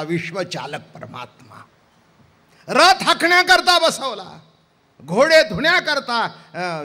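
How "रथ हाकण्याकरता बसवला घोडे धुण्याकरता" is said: loud, high indignant preaching; indignant outcry. Acted. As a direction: rail against the insult. Indignant preaching about Arjuna. (संतप्त, मोठ्या आवाजात)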